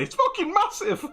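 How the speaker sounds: high, loud imitated shriek. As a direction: squeal it in alarm. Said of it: "Did you hear me say".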